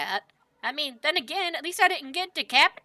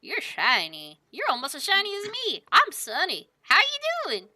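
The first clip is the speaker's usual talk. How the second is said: put-on cheery cowboy baby talk; cooing to otter; 4 semitones higher than usual. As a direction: coo at a little animal. Sunny greets otter.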